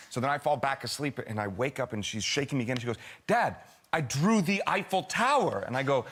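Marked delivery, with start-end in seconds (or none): 3.3-5.6 put-on higher, slow child impersonation; proud child. Daughter wakes dad to show drawing, proud child. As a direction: announce it like a small daughter showing off.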